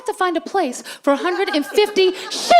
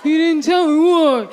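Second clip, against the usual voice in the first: husband voice; 4 dB louder, slower than usual. Retold or imitated. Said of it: "same husband voice".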